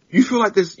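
a laughing, amazed challenge to a friend; mock disbelief. Stunned by Spurs friend.

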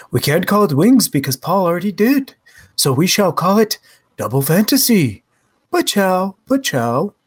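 lightly voiced Lennon accent; a hint of Liverpool Lennon; [name] impression. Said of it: "Again, not bad, [name]".

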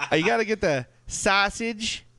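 high, slow, exaggerated Chicago accent. Shown as a demonstration. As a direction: ham up a thick Chicago accent. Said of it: "how to do a Chicago accent".

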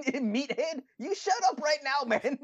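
very high, laughing mock telling-off; high giggling mock rebuke; squeaky mock scolding. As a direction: squeak it out while cracking up. Mocking voice amid laughter.

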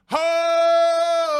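a sudden high, loud "Ho!" like a crowd chant; stadium chant shout. Pretend. Host tries out the home crowd's chant, shouted high. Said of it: "I hope I'm doing that right".

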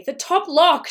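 high, loud urgent cry; desperate. A narrator's reading. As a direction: call out desperately for help. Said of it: "the woman's voice, desperate and breathing heavily".

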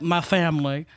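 a hint of a villain's low voice; movie villain imitation. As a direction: drop low for a mock movie line. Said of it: "the man who was like".

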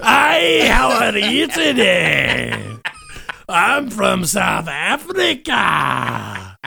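loud, high, drawn-out fake South African shout; Elon Musk. Host posing as billionaire guest greets listeners, hammed-up South African accent. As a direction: bellow a hopeless South African accent, stretching each phrase. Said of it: "we can't do any accents".

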